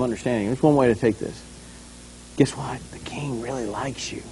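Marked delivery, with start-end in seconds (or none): 2.4-2.8 whispered innuendo. Acted. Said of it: "kind of the whisper". 2.9-4.2 low, much softer, underhanded; whispered innuendo. Acted. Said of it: "kind of the whisper, innuendo".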